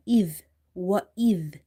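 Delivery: wa idh, th sound from the tip of the tongue, slow; dhal with tongue tip. Shown as a demonstration. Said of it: "This dhal is from the tip of the tongue".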